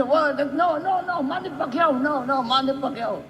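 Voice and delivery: high, insistent chant for Manny; excited cheering. Retold or imitated. Filipina wives cheering Manny over their English husbands. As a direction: cheer him on like a fan.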